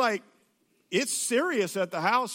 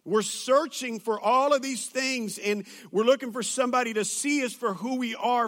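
usual speaking voice

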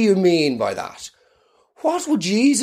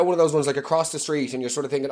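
usual speaking voice

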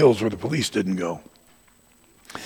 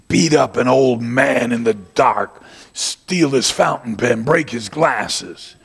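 louder, very slow and deliberate; preacher louder and slow, rebuking the gang; slow angry rebuke; 5 dB louder, much slower than usual. Retold or imitated. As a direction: spell out their crimes slowly.

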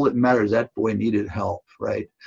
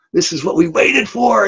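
much higher and louder burst; excited cry; 10 semitones higher, 4 dB louder than usual. Retold or imitated. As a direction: fire up the team at the big moment. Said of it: "we're all excited".

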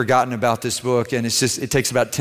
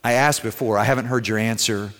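usual speaking voice

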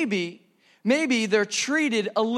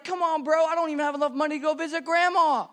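jealous whine; 6 semitones higher than usual. Retold or imitated. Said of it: "And you're like".

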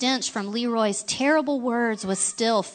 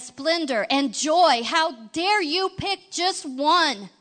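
loud, slow, higher furious rebuke; slow, louder fury, pitched up; rumbling with power; 5 semitones higher, 3 dB louder than usual. Acted. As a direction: thunder at Leroy, slowly and higher. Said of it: "his voice rumbled with their power".

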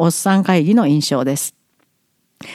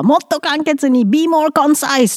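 nagging instructor; 6 semitones higher, slower than usual. Retold or imitated. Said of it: "って毎日言われました".

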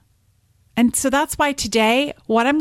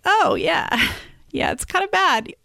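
much higher, falsely cheerful with a nervous laugh; forced calm; 8 semitones higher than usual. Retold or imitated. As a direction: pretend it's fine while hiding panic. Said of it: "I had to try and be like".

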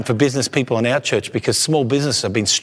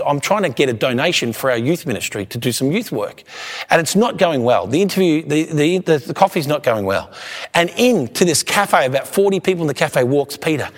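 ordinary talk, nothing marked

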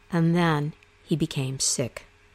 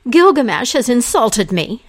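high, loud furious complaint; an insulted goddess fuming to her father; 5 semitones higher, 8 dB louder than usual. Acted. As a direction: spit it out, outraged and wounded. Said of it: "she fumed".

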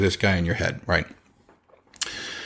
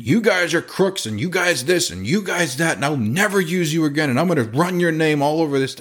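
higher mock rant, hammed-up outrage; retold furious customer, pitched up; angry rant; 7 semitones higher than usual. Home seller calling the chimney company crooks, mock outrage. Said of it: "swearing like a sailor at me".

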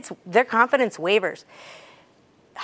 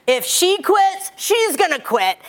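much higher, louder, slow coach bark; tough coach; 10 semitones higher, 4 dB louder, slower than usual. Retold or imitated. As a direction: hammer it like a tough strength coach. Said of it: "she's kinda tough".

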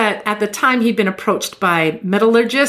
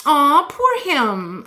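mock crowd's pitying aw, a bit higher; sympathetic aw; 6 semitones higher, much slower than usual. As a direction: coo like a pitying audience. Audience pities Geller.

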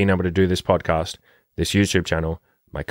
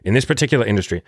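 ordinary delivery

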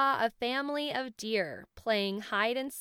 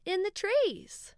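ordinary delivery, nothing marked

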